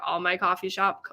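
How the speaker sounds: normal talking voice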